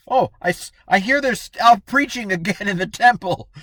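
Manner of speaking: a hammed-up nervous messenger; flustered servant. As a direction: blurt it out like a panicked lackey. Servants report the apostles are gone.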